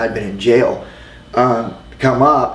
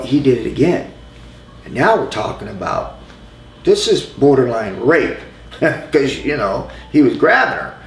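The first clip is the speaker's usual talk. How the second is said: usual speaking voice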